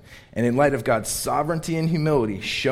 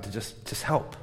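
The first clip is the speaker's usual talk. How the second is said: holding back tears; 8 dB quieter than usual. Real, not acted. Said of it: "I'm gonna try not to cry".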